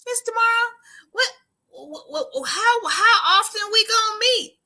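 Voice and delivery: eager students. Retold or imitated. Boot camp recruits asking the father, high and eager. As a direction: pipe up like a keen recruit. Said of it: "raising their hand and saying".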